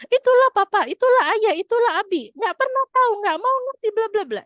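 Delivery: very high, loud tirade of blame; hammed-up shrill nagging; high intonation, nagging. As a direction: mimic a wife scolding her husband at full pitch. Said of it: "intonasinya tinggi".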